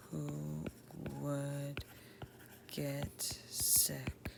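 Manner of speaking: very slow, hushed voice with long pauses; composing aloud while writing. Shown as a demonstration. Teacher drafting an isolation monologue in a journal, slow and hushed. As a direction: murmur it word by word as you write.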